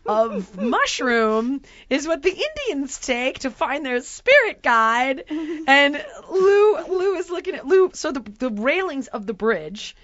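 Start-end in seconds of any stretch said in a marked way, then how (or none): 0.0-5.2 very slow, solemn tour guide voice; slow mock guide lecture. Guide explaining the mushroom species, slow and solemn. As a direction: spin it out like a grave nature lecture. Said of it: "the guy's like".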